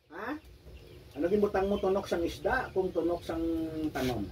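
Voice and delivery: ordinary delivery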